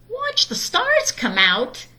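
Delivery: high, slow whiny complaint; complaining cub. A narrator's reading. Sister grumbling to Mama about stargazing, high and whiny. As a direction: drag the question out in disbelief. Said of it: "complained Sister".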